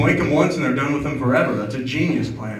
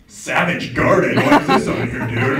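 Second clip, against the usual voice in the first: mock teasing friend; 3 semitones higher, 6 dB louder, slower than usual. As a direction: sneer at the playlist. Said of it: "they're like".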